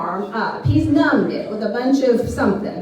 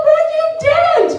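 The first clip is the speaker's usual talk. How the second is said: very high, wailed retort; hammed-up upset comeback; quarrelling retort; 4 dB louder than usual. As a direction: throw it back high and loud. Acted quarrel with husband.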